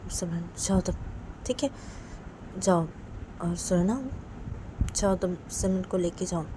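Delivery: soft, gentle motherly coaxing; soothing mother. Acted. Mother comforting her crying daughter, soft and tender.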